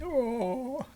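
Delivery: high, drawn-out yawn sound; mock yawn. Recapping how the woman just yawns, mock yawn. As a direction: imitate a big yawn, pitch up. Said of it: "She's just like you, Awning".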